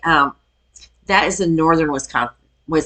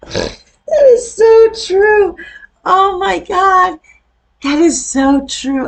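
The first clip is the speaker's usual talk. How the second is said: high, slow, stretched-out laughing exclaim; cracking up while agreeing; laughing disbelief; 13 semitones higher, 7 dB louder, much slower than usual. Real, not acted. Reacting to funny Midwest clip.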